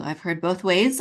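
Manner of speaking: ordinary delivery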